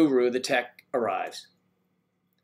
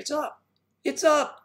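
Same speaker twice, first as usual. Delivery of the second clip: much higher, delighted; squeaky high triumphant; excited; 12 semitones higher than usual. Real, not acted. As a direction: cheer that it finally works. Triumph when music works.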